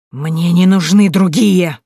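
loud, slow growling snarl; growled. A narrator's reading. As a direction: growl it out in fury. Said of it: "зарычала старуха".

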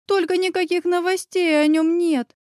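high-pitched tearful complaint; whiny, understated weeping tone; plaintive wail. A narrator's reading. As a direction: lament it as if about to cry. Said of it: "провыла Оля".

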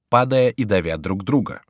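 plain reading voice

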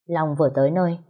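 usual speaking voice

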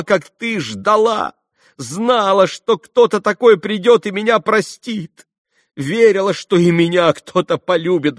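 high, loud, impassioned outpouring; fervent cry, building to the end; frenzied confession. A narrator's reading. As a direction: pour it out as if in ecstasy. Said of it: "как бы в выступлении".

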